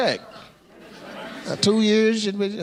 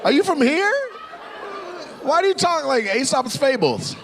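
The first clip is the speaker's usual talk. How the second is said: disbelief; 12 semitones higher than usual. Real, not acted. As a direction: shriek in comic disbelief at his answer. Comic disbelief at crowd member.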